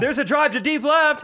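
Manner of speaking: high, loud excited call; hammed-up announcer; mock play-by-play. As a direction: yell it like a home run call. Imitating a baseball call.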